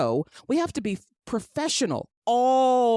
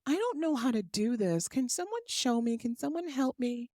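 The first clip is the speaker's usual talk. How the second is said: higher, softer, needy damsel voice; mock helpless woman, softer and higher; 5 semitones higher, 4 dB quieter than usual. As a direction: beg sweetly like you can't manage anything. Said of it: "you also have to be".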